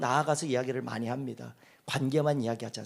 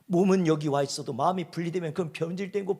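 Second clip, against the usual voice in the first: ordinary talk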